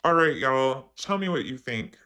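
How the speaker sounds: ordinary talk, nothing marked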